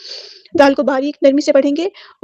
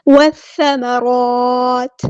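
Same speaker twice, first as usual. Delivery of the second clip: slow, drawn-out melodic tajweed recitation; Quran recitation; much slower than usual. Shown as a demonstration. Teacher recites the verse for students, slow melodic chant. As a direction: chant each word slowly in tajweed.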